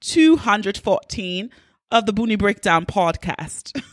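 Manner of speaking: hammed-up British accent greeting; playful, badly faked British accent; mock British accent. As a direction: open the show in a put-on British accent. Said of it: "a British accent".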